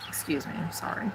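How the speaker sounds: whispered apology. Real, not acted.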